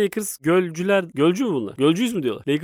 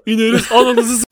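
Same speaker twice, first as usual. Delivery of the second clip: laughing mock fan yell; 6 semitones higher, 7 dB louder than usual. Mock fan threatening the players.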